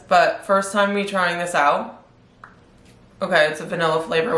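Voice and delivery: ordinary talk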